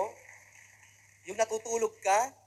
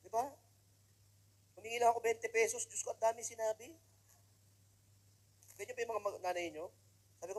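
ordinary talk, nothing marked

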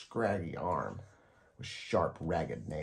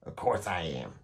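snapped. A narrator's reading. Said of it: "she snapped".

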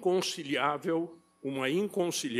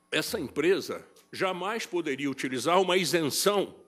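usual speaking voice

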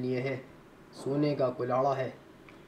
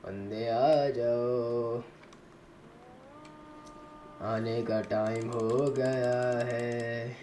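drawn-out taunting call; 3 semitones lower, 3 dB quieter, much slower than usual. Pretend. Luring enemies into a fight.

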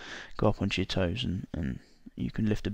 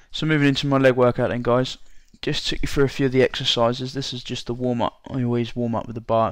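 usual speaking voice

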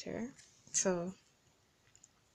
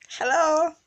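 higher, louder sing-song hello with a laugh; cheerful loud greeting; 7 semitones higher, 8 dB louder than usual. Shown as a demonstration. Vlogger acting out greeting a group of people, cheerful call. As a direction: greet a crowd like you're glad they came.

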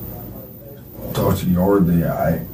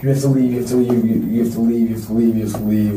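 imitated frantic insistence; 2 semitones higher, much faster than usual. Former girlfriend at her desk ordering him out, imitated insistence. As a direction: imitate her insisting, pitch easing down as it speeds up.

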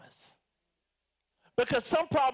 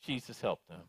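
much lower and softer sighing plea; mock-exasperated prayer for a hopeless flock; half-joking murmured prayer to Jesus; weary prayer; 12 semitones lower, 9 dB quieter than usual. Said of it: "imagine Bishop praying that prayer for us".

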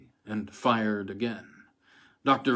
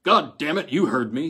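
a louder roar; 5 semitones higher, 6 dB louder than usual. A narrator's reading. Colonel overrides the doctor's order, loud roar. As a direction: roar it down as a commander. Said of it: "Colonel Hampton roared".

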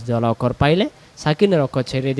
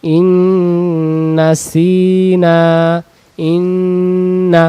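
ইন্না with the nn held very long; ইন্না drawn out with a long nasal hold; 4 semitones higher, 4 dB louder, much slower than usual. Shown as a demonstration. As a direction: hum the nasal in ইন্না slowly. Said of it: "গুণ না হবে ইদগমের".

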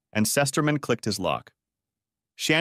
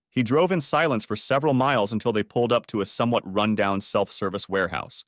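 usual speaking voice

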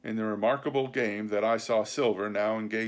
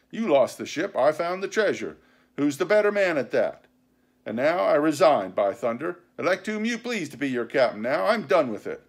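higher-pitched, forceful pirate oratory; indignant sea cook Silver; 4 semitones higher, 5 dB louder than usual. Acted. Silver resigning before his crew, indignant. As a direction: throw down the command in a huff.

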